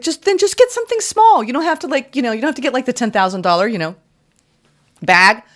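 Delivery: high, pleading voice talking them into a small buy; coaxing Spirit. Acted. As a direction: lift the voice and coax gently.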